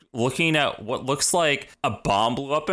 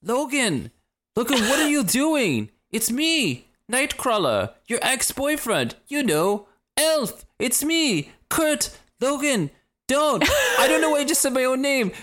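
high, pleading Kurt, much higher than her own voice; 12 semitones higher than usual. Retold or imitated. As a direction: play a needy Nightcrawler begging Logan to remember.

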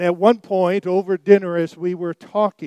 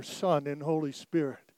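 ordinary talk, nothing marked

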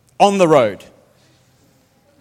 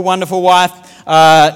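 ordinary delivery

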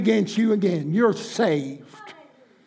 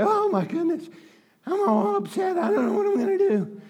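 mock a fretting believer; mock fretful worrier; 6 semitones higher than usual. Preacher mocking a worried believer's panic. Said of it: "I'm not making fun of anybody".

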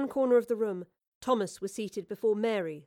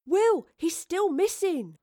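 put-on worried boy; 9 semitones higher, 5 dB louder, slower than usual. A narrator's reading. Boy worried about absent Robert.

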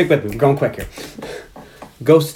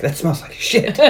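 laughing through line; 3 semitones higher, 4 dB quieter, slower than usual. Retold or imitated.

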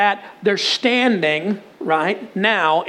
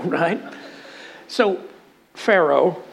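ordinary delivery

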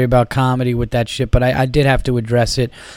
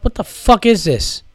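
higher-pitched, exasperated retort; retold exasperation; 7 semitones higher than usual. Annoyed traveler reacting to airport staff, retold exasperation.

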